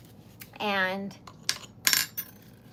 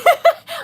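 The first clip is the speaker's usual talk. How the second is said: loud delighted laugh; 15 dB louder than usual. Real, not acted. Can't believe her luck.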